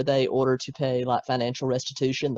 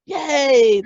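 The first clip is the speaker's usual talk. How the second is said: excited cheer; 12 semitones higher, 9 dB louder than usual. Real, not acted. Cheering the announcer bit.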